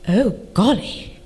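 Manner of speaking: low, slow, mock-posh English; posh boyfriend. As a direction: imitate a posh Englishman's understated reaction. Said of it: "he's awfully posh".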